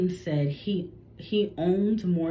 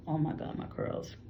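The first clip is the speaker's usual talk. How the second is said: softer, dropping to a breathy aside; sudden hushed aside; 8 dB quieter than usual. Real, not acted. Vlogger notices her hair, softer aside to herself. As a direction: catch yourself and murmur it under your breath.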